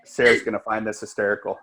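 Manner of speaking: ordinary talk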